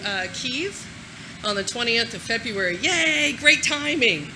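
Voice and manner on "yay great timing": sarcastic yay. Acted. Ironic cheer about war timing.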